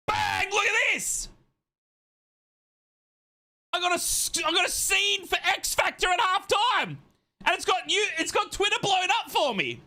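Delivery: very high, excited squeal; giddy showing off. Real, not acted. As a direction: boast in a high-pitched, over-excited yell. Showing off new stream scene.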